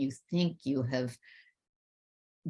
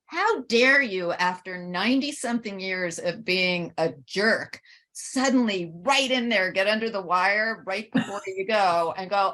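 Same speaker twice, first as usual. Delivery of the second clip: retold anger; 4 semitones higher than usual. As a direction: let the old anger rise up, but it is a retelling. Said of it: "And then I get angry".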